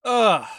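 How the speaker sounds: loud, exasperated "ugh"; exasperated groan. Real, not acted. Giving up on pronouncing names, loud exasperated groan.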